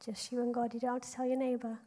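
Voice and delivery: softly, so as not to disturb the praying; hushed aside. Real, not acted. Leader murmurs to praying children, softly. Quiet aside during silent prayer.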